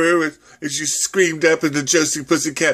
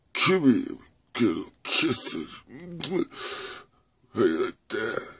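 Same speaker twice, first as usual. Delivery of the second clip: low seductive coo; 5 semitones lower than usual. Acted. Lover coos over singer.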